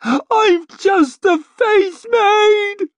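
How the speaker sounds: very high, loud, drawn-out scream; desperate shriek. A narrator's reading. Maid being dragged through the gate, shrieking. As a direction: shriek each word slowly in terror. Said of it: "she shrieked".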